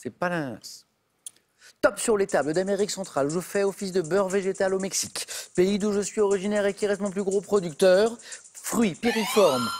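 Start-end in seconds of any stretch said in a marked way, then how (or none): 1.8-9.7 énoncer l'indice comme un animateur de quiz; lecture de question de quiz. Acted. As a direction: question lue d'un ton appuyé, un peu plus fort. (voix d'animateur qui lit la question, posée et appuyée)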